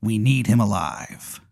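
slow, hushed pirate voice; pirate tomato. Acted. Pirate tomato tells Aaron the monster must live, slow and soft. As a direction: draw it out like a quiet pirate. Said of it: "he's a pirate".

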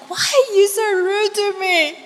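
put-on high, small child voice asking why; hurt toddler. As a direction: voice the little boy, hurt and puzzled. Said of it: "he's like".

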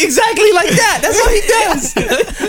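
high, slow words squeezed out through laughter; barely able to speak for laughing; quoting the teacher while helplessly laughing; laughing hard. Retold or imitated. Teacher quote while cracking up.

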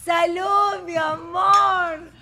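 loud, high, drawn-out toast; exuberant toast. Real, not acted. Host toasting her guest, exuberant drawn-out call. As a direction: sing out the toast, stretching the last word.

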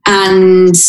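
ordinary delivery

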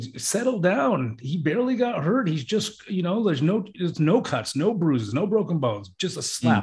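high-pitched exasperated pleading; exasperated dad. Retold or imitated. Father telling the mother to settle down, high exasperated. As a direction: tell them it's nothing, half amused. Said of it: "And I'm like".

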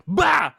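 loud sudden fake sneeze; imitated dad sneeze. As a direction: blurt out a big sneeze. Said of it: "It just suddenly is like".

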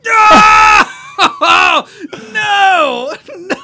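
high, loud, panicked no's with a laugh; panicked screaming. Retold or imitated. Retelling the spider discovery to a friend, shrieking. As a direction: scream it like you've just seen spiders. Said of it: "I was like".

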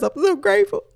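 very high, squeaky mock sobbing; imitated grateful weeping. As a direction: act out crying a thankful prayer, voice way up high. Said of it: "I'm crying and I'm weeping".

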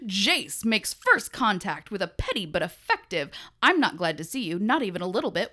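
loud play-by-play boxing commentary; old-timey announcer. Pretend. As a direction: call the fight like an old radio announcer. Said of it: "it's in the old-timey voice".

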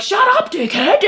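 very high, giggling through it; laughing mock insult. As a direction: fake a heckler put-down while laughing. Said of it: "Normally I'd be like".

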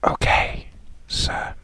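hushed whisper back. Real, not acted. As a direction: whisper the reply. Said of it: "whispered reply".

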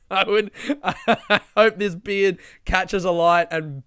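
laughing at his own misery. Real, not acted.

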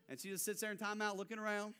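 ordinary delivery

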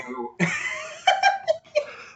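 a big high laugh; real laughter bursting out. Real, not acted. Bursts out laughing mid-story.

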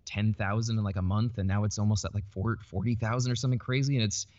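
normal talking voice

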